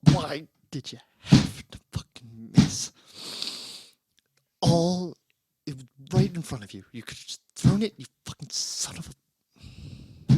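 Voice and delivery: angry rant. Acted. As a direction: spit the blame at the corpse, half whispered at the end.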